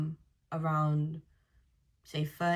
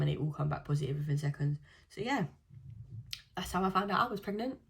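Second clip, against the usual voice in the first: ordinary talk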